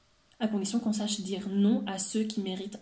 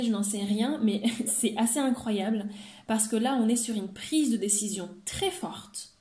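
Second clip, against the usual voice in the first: normal talking voice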